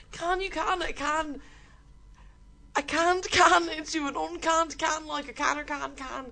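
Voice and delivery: put-on D4 accent fighting giggles; Dublin 4 accent. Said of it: "In your best D four accent".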